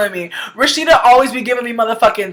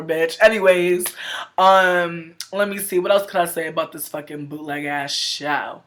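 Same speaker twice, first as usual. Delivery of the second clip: ordinary talk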